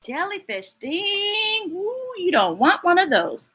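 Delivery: ordinary delivery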